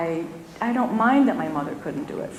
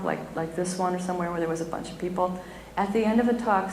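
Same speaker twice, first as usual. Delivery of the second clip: usual speaking voice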